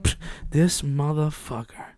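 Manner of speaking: slow soft mutter; quiet muttering. Retold or imitated. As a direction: grumble it under breath. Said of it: "I'm like".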